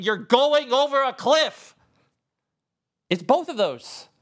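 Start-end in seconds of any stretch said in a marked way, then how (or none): 0.0-1.7 higher, louder warning cry; warning shout. Retold or imitated. Wisdom shouting a warning at the listener, a mock alarmed yell. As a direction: call out the danger. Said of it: "which is the shout saying".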